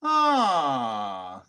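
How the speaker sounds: long, loud falling "aah"; exaggerated sigh of dawning curiosity; curious "ah". Shown as a demonstration. Man showing curiosity kicking in, exaggerated "ah". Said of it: "curiosity kicks in".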